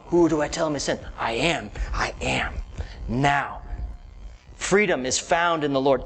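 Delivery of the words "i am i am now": low reverent whisper; hushed, lower reverent whisper. Acted. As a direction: breathe I am as a secret. Quoting God's name, hushed.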